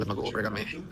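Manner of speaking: ordinary talk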